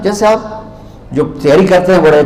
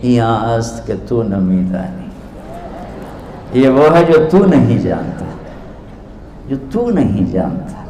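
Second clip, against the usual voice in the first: softer, gentle innocent answer; innocent reply; 4 semitones lower, 5 dB quieter than usual. Retold or imitated. Mystic gently answers the proud scholar, softly innocent. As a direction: hand the words back quietly and sweetly. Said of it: "बड़ी मासूमियत से बोले".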